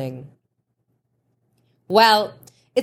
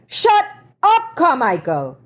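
high, loud furious cut-off; shouting; 7 semitones higher, 4 dB louder than usual. A narrator's reading. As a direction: bark him silent. Cortland cuts off photographer.

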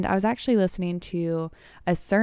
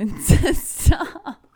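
stifled giggles; 8 semitones higher, 7 dB louder, much slower than usual. Real, not acted. Cohost cracking up during reading.

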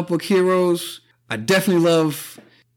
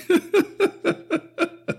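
teary laugh; 14 semitones higher than usual. Real, not acted. Host still laughing after breaking down over Endgame. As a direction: let the laugh fade out. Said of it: "I can't even fucking talk".

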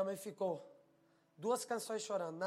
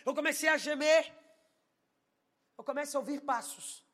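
loud, raised, urgent testimony; full-force preaching; 7 semitones higher, 10 dB louder than usual. Acted. Preacher retells a night of prayer, impassioned loud delivery. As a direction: preach it loud, driving the story forward.

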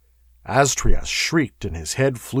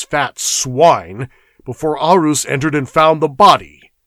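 angry; 4 semitones higher, 7 dB louder than usual. Acted. Conan angrily accuses the noble.

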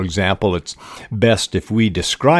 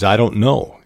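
normal talking voice

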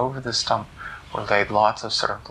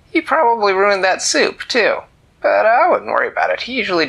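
put-on higher, louder excited old cat; the cat waves off the worry; lightly voiced lively cat; cat explaining; 9 semitones higher, 6 dB louder than usual. Cat voice animated.

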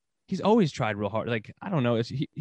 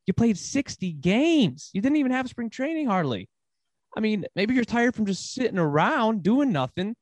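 incredulous; 8 semitones higher, slower than usual. Real, not acted. Incredulous rant at player's excuse.

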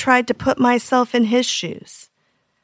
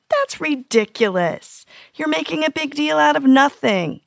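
dismissive parent; 6 semitones higher than usual. Retold or imitated. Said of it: "making light of their feelings".

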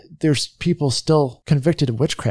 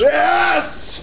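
high excited shout. Acted.

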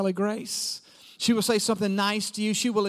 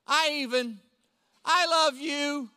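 put-on little boy; 7 semitones higher than usual. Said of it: "And then he said".

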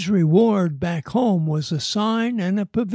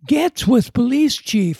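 loud; 6 semitones higher, 6 dB louder than usual. A narrator's reading. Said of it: "Fred said".

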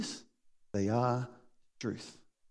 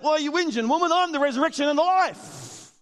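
impatient Jesus; 10 semitones higher, 3 dB louder than usual. Retold or imitated.

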